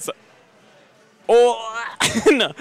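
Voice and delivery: ordinary talk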